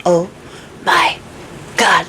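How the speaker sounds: halting, low disbelief; stunned realization. Retold or imitated. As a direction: let each word land separately, mock awestruck. Queen realises she has the name.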